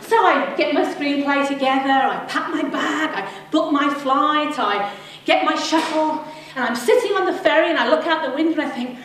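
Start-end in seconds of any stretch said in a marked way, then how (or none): none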